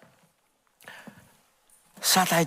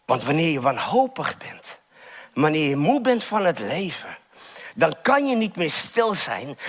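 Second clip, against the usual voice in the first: ordinary talk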